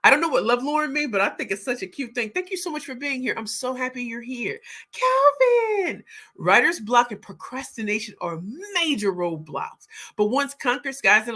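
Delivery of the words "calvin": excited. Real, not acted. Calling out a viewer's name.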